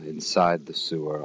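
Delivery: ordinary talk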